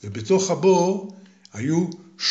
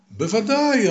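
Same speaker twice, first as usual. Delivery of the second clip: eager; 9 semitones higher, slower than usual. Acted. Hero cheerfully agrees.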